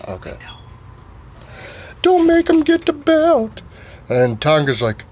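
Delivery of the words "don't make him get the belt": squeaky high warning voice; hammed-up high-pitched drunk buddy; high mock warning. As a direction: warn him in a silly high voice. Repeat, high and slower.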